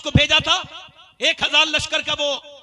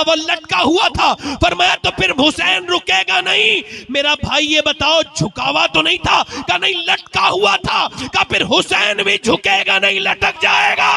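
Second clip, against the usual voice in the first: preaching shout; 6 semitones higher than usual. Acted. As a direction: build to a shouted climax. Climax of Karbala dialogue, shouted.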